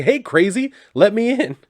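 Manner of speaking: high, loud, cheery call; happy pest calling to be let in; gleeful greeting. Retold or imitated. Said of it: "just the happiest face on".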